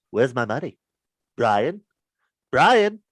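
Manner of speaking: building to a loud shouted Brian; Family Guy impression. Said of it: "Do that good".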